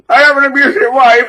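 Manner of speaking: high, loud mock bawling; hammed-up wail to the cops; fake crying. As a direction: bawl it as a fake victim. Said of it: "start crying and going".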